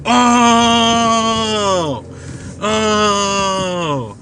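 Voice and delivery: long, high drawn-out "oh" of a celebrating table; going crazy. Retold or imitated. Me, the GM and my roommate celebrating a nat twenty, going crazy. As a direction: imitate players going wild over a big roll. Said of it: "we're going crazy".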